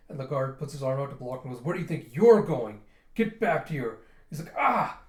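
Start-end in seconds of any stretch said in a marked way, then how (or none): 1.6-4.0 loud, acted challenge; angry guard. Guard blocking Yona as she lunges, angry. 4.6-5.0 loud, high acted "ah"; sudden high cry of pain; pained yelp. Guard as Yona bites his arm, pained yelp. Guard yelps when bitten.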